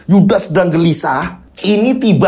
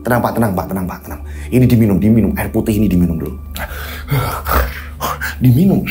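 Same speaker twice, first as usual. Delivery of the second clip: soothing; 8 semitones lower, much faster than usual. Acted.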